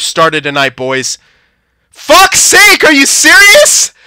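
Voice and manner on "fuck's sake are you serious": frustrated outburst. Real, not acted. Goal against, frustrated outburst.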